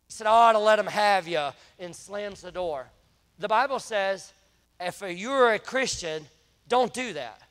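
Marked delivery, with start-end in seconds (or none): 0.3-1.5 loud retold yell; yelling at the kid. Angry officer yells at the boy who shot, loud retold yell. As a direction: shout it at the kid, much louder. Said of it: "he yells to the kid".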